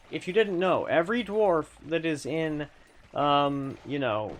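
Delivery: ordinary talk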